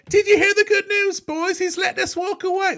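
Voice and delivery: high, bright falsetto mimicking the gleeful settler; mock eager Big Rebecca. Podcast host mimicking Big Rebecca cheering to the boys, high falsetto. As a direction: squeal it out like a giddy townie. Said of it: "she really wants to leave".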